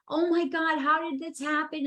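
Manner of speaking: replaying her shock at the weight gain; dismayed surprise. To her podcast co-host, replaying her shock at the weight gain, mildly dismayed. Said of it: "And I'm like".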